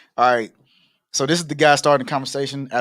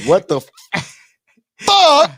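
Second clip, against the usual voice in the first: high, loud cuss through a laugh; laughing out a high loud curse; 13 semitones higher, 8 dB louder, slower than usual. Real, not acted. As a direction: crack up mid-swear, slow and loud. Mock cussing, laughing at herself.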